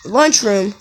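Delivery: usual speaking voice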